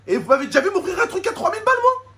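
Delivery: rising indignant question; outraged disbelief. Real, not acted. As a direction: soar up in disbelief. Outraged rhetorical question to subscribers. (indignation qui monte)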